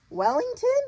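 high, loud rising query; storybook Sophie, taken aback; surprised question. A narrator's reading. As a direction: exclaim it as a startled question. Sophie surprised by the giant's claim.

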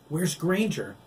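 high, loud worried question; concerned. A narrator's reading. Jake asking the pack about Granger. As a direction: ask with worry.

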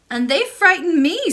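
high, bright little princess voice; excited young princess; cartoon princess. Princess answers the old lady.

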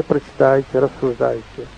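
usual speaking voice

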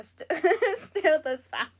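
laughs through the words; amused, breaking into a laugh mid-phrase. Real, not acted. Answering a commenter, amused.

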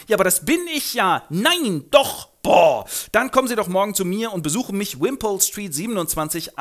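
hoch und laut begeistert; freudige Überraschung. Acted. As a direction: freut sich über das Treffen. Pickering und Higgins freudig. (hoch und begeistert)

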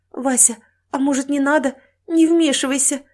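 high, anxious wife's warning; nervous plea rising to urgency; fearful pleading. Acted. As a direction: timidly talk husband out of it. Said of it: "Со страхом посмотрела".

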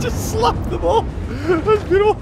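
very high, giddy delight; gleeful squeal. Real, not acted. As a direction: cheer on the mayhem in a squeal. Gleeful in the swarm fight.

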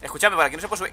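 ordinary talk

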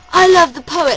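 a mild retold shout from the crowd. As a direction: call it out into the silence. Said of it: "I, I shouted".

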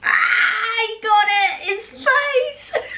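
gleeful high shout; real burst of laughing glee; excited shout. Real, not acted. Other player bites a bad bean.

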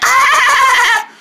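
very high giddy "ah"; high-pitched silly laugh. Acted. Showing the voice not to use, high squealed laugh. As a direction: mimic the annoying high cackle. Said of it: "just not with that".

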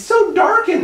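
high, loud falsetto dread; hammed-up frightened exclamation; mock alarm. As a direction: imitate a spooked friend. Retold friend's alarm, high and loud.

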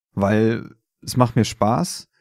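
ordinary delivery

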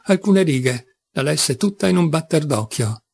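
plain reading voice

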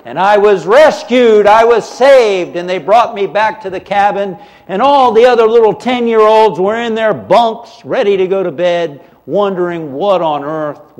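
loud, high and laughing; excited. Real, not acted. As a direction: burst out with relief and joy. Joyful rescue climax.

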